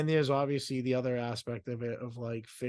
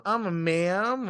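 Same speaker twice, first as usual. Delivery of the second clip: mock offended; 7 semitones higher, 7 dB louder, slower than usual. Mock offense at being called ma'am.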